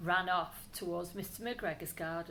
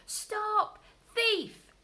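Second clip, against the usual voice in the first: high loud angry shout; 13 semitones higher, 8 dB louder than usual. Acted. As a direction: yell after the thief.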